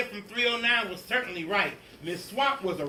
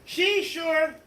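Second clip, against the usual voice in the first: much higher, louder eager answer; eager kids; 10 semitones higher, 6 dB louder than usual. Acted. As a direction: kids covering fast, up high.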